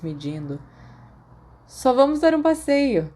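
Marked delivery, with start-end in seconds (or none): 1.7-3.1 higher, louder, lofty and dismissive; haughty schoolboy tossing off an answer; haughty reply. Acted. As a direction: brush him off from above. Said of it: "Sirius respondeu-a altivamente".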